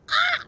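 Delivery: high startled ah; startled yelp. Retold or imitated. Retelling a scary overlay, a high startled yelp. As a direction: yelp as if jumped at. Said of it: "and I was like".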